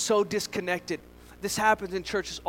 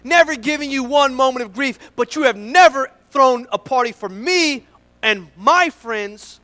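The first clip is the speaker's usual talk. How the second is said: louder, higher aggrieved protest; resentful complaint; 4 semitones higher, 8 dB louder, slower than usual. Acted. Older brother complaining to his father, loud and aggrieved. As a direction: pour out years of grievance. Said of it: "The son said".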